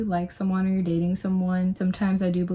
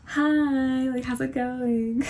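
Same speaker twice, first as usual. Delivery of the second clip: fake persona voice; 6 semitones higher, much slower than usual. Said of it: "fake persona ... kind of voice".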